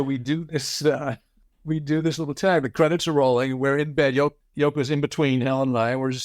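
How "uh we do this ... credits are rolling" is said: usual speaking voice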